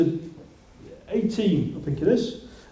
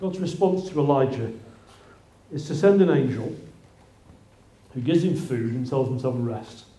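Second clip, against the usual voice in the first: ordinary talk, nothing marked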